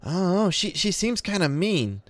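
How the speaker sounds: high, light critter voice for Lime; squeaky little raccoon musing about her. Acted. GM as raccoon Lime sizing up the new girl, high critter voice.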